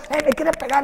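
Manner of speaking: high-pitched mock yell for help; retold yelling. Boxer retelling his cry to the coach, high mock yell. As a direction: raise the pitch as if calling out in alarm. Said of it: "Me empecé a gritar yo".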